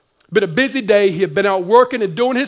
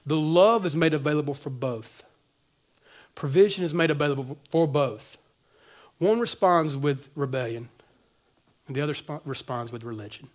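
hushed solemn preaching; quiet sermon point; 6 semitones lower, 7 dB quieter than usual. Acted.

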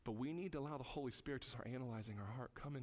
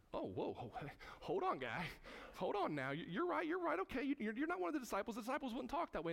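high, startled backpedalling; crowd backing off; 10 semitones higher than usual. Retold or imitated. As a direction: back off fast, caught out.

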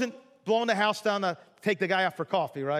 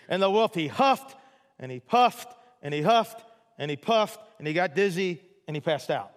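normal talking voice

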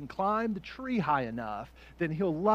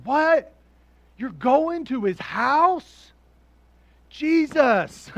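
louder, higher indignant protest, slow in places; outraged townsperson; 8 semitones higher, 9 dB louder, slower than usual. Pretend. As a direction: complain like a cheated neighbor. Voicing outraged crowd member.

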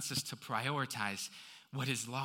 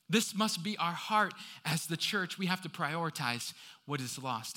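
ordinary talk

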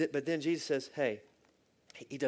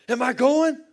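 much higher and very loud, alarmed; startled dying man; 13 semitones higher, 19 dB louder than usual. Retold or imitated. Dying friend startled awake, shouting high. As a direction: jolt awake asking in alarm.

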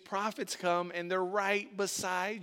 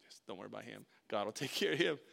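soft aside; 8 semitones lower, 5 dB quieter, faster than usual. Retold or imitated.

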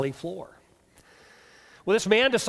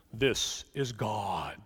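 low, slow booming God voice; deep voice of God; 9 semitones lower, 6 dB quieter, much slower than usual. Acted. God answers the hanging hiker, low slow voice. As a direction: announce yourself as the Almighty, low and slow. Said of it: "he hears a deep voice".

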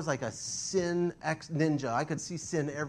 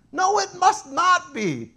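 mocking correction; 10 dB louder, slower than usual. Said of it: "mocking his old self".

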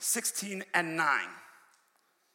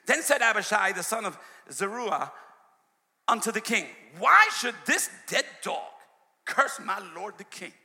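louder, declaiming King James verse; scripture reading; 2 semitones higher, 5 dB louder than usual. Acted. Preacher declaiming scripture to the church.

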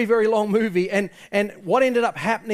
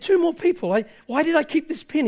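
high, anguished self-reproach; retold breakdown, pitched high and urgent; breaking down; 9 semitones higher than usual. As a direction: plead as regret overwhelms you, without real tears. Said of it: "he's starting to break down".

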